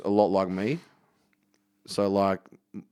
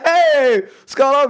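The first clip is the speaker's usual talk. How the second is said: much higher, louder cheery hey; loud high greeting; 14 semitones higher, 9 dB louder, slower than usual. Retold or imitated. Turning around to greet an angry friend, a loud high hey. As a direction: call out cheerfully to a friend behind you.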